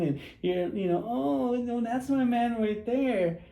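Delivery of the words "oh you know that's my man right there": higher, drawn-out simpering; mock coy woman. Woman to her admirer about her man, mock coy. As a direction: imitate her half-heartedly pointing out her man.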